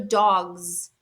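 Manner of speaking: "dogs" ending in a drawn-out buzzed z; voiced ending. Shown as a demonstration. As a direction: let the end of "dogs" buzz. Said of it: "going to be the voice sound".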